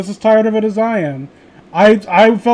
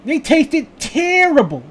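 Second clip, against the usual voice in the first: higher, slow cartoon voice; South Park bit voice; 6 semitones higher, slower than usual. Echoing the South Park bit in a high slow voice. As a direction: drag it out in a cartoon voice. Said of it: "do that bit from South Park".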